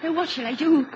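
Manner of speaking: ordinary talk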